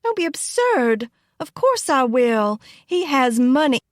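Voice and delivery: high, scornful retort; dismissive. A narrator's reading. Girl dismissing cousin's worry about happiness, scornful.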